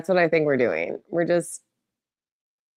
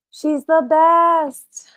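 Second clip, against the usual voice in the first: high, louder, slow gush; high gushing praise; 12 semitones higher, 6 dB louder, much slower than usual. Real, not acted. Owner gushing loudly about her cat, high and slow. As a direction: burst out with adoration.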